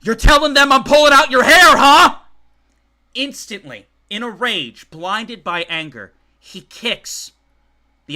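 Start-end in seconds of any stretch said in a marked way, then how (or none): 0.0-2.2 high loud raging shout; enraged father accusing his daughter; furious accusation. Acted. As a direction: hurl it at her.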